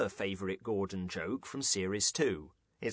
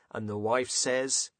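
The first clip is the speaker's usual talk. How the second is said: usual speaking voice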